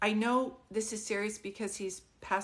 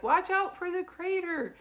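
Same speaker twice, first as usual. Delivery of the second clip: higher, louder joking husband voice; teasing husband; 9 semitones higher, 5 dB louder than usual. Retold or imitated. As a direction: tease her like her laughing husband. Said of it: "he's laughing and he says".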